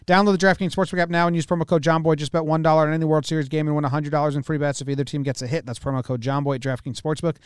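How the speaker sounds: rapid-fire ad disclaimer; fast legal disclaimer. Acted. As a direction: rattle off the fine print fast.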